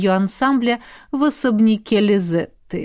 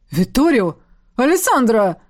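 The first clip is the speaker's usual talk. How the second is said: громкий возглас; 3 dB louder than usual. Acted. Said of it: "третий возглас".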